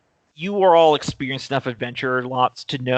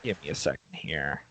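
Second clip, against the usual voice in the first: usual speaking voice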